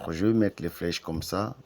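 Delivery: ordinary talk, nothing marked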